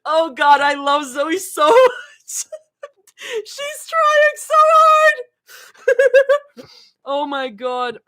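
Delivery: high, strained cry of laughter; laughing squeal. Real, not acted. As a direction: squeal through helpless laughing.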